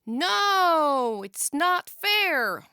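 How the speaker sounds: loud, high, slow wail; a drawn-out upset wail. A narrator's reading. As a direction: wail at the unfairness. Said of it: "wailed Summer".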